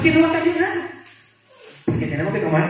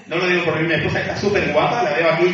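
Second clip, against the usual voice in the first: ordinary talk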